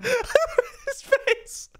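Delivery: very high, cracked creepy shriek and laugh; a manic high-pitched villain laugh; evil flower cackle. Acted. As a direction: screech and cackle like a monstrous flower. Flowey turns monstrous.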